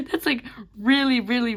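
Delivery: high giggle into words; laughing. Real, not acted. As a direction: laugh while talking. Laughing at old drawing.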